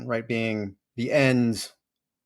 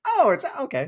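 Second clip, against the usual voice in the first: high offhand mock shrug; mock offhand self; 14 semitones higher, 3 dB louder than usual. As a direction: toss it off lightly in a high voice. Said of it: "And I was like".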